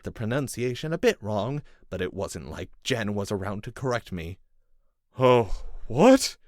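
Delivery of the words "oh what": startled. A narrator's reading. Captive man startled.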